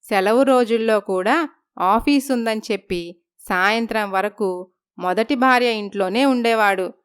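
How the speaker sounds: usual speaking voice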